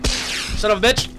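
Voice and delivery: high, loud protest; alarmed yelling. Real, not acted. Player grabbed by a game enemy, alarmed yelling. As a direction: fend off an attacker, loud and high.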